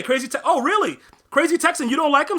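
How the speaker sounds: high, loud disbelieving surprise; can't believe a Texan fan said that; surprised disbelief. Real, not acted. To chat viewer Crazy Texan who dislikes Dak, high loud surprise.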